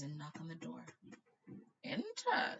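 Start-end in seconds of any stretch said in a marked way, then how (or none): 1.8-2.6 much higher, lightly voiced old wizard; a frail old headmaster calling; feeble old voice. Old headmaster answering a knock, feeble and high. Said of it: "in a feeble voice".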